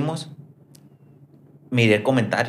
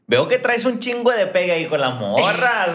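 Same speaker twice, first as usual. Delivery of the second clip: teasing; 6 semitones higher than usual. Real, not acted. Host teases guest about popularity.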